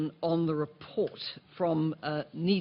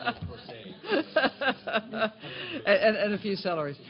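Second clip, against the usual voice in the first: laughing through the words; 6 semitones higher, faster than usual. Real, not acted. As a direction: chuckle out the tail of the joke. Laughing after the rent joke.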